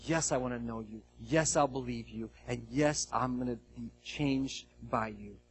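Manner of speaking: soft, low prayerful voice over music; quiet prayer. Acted. Pastor leading the congregation into communion, soft and prayerful. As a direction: pray it gently, almost hushed.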